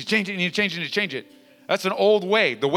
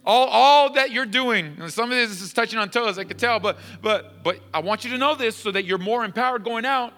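raised pitch, emphatic and driving; forceful preaching; 7 semitones higher, 4 dB louder than usual. Real, not acted. Pastor telling church that cursing is unbiblical, forceful preaching. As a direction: press the point on the congregation.

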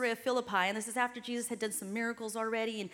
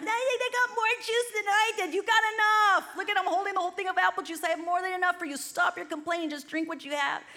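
exasperated mom; 6 semitones higher, faster than usual. Retold or imitated. Mom scolding complaining kids.

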